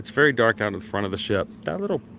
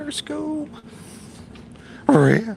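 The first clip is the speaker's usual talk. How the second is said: Scooby-Doo voice; much slower than usual. Pretend. Spooky ship, Scooby-Doo joke.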